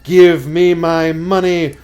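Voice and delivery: slow ghost wail building to a very high, very loud YOU; jump-scare shout. A narrator's reading. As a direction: build steadily, then scream the last word. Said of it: "the woman cried".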